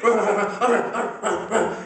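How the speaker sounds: high, loud laughing yaps; frantic barking. Retold or imitated. Comic acting out his dog barking wildly at the door. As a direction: bark like a dog freaking out.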